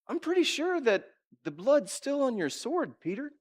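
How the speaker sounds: high-pitched mock accuser; challenging accuser. Servant confronting Peter by the fire, high mock challenge. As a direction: needle him with a high, knowing challenge. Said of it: "He challenged him and said".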